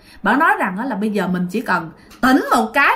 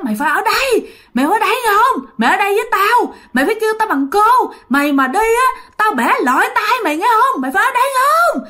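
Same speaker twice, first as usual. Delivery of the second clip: put-on high, loud shrieking threats from a spooky old woman; menacing ghost woman; 10 semitones higher, 3 dB louder, faster than usual. Ghost woman threatening the trapped dreamer, high shrill menace. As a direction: screech it like a ghost holding a child captive. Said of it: "bà làm thấy ghê lắm".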